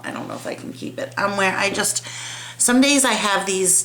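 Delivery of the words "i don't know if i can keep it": whispered. Real, not acted. Doubtful murmur to herself.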